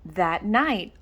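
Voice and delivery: plain reading voice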